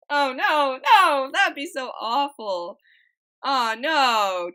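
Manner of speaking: loud, drawn-out dismayed protest; amused horror, loud and stretched; appalled outcry. Real, not acted. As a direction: cry out at an awful prank idea. Appalled, amused by prank idea.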